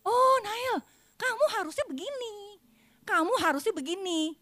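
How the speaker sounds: put-on high, pushy parent voice correcting a child; imitating a nagging mother. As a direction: mimic a bossy parent lecturing. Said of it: "orang tua tuh langsung gini".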